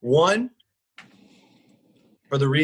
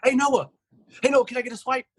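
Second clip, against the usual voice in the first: higher-pitched mock staffer asking a favor; pestering staffer; 9 semitones higher, faster than usual. Staff catch a manager leaving to ask for a swipe, pestering. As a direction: chirp it up like a needy coworker.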